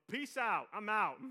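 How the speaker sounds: loud, high, cocky send-off; youngest brother. Acted. Preacher acts out youngest brother leaving home, loud and carefree. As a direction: call it out high and loud as the kid walking out. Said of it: "The youngest brother is pretty much going".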